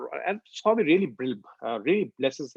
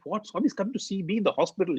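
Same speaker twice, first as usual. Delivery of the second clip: higher, rushed disbelieving question; astonished retold question; shocked; 6 semitones higher, faster than usual. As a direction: imitate a friend's shocked question, quickly.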